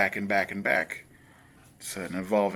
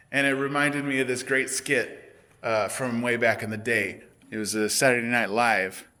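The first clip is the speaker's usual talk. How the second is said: usual speaking voice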